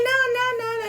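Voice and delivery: put-on very high, whining little-kid "não"; imitated crying toddler. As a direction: whine like a three-year-old refusing. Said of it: "ele começou a chorar".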